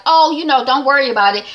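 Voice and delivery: man brushing off the boss's wife, high and loud. Retold or imitated. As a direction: wave her off loudly, high. Guy brushing off Connie.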